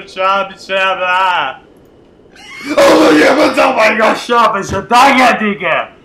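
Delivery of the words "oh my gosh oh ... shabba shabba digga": high, loud shrieks of glee; shrieking jackpot winner. Real, not acted. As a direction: scream like you just hit the jackpot. Shrieking wins at the game.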